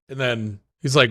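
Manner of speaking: ordinary delivery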